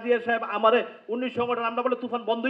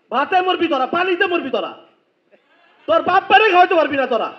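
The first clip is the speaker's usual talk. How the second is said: mocking taunt; 4 semitones higher, 7 dB louder than usual. Real, not acted. Taunting YouTubers, crowd laughing.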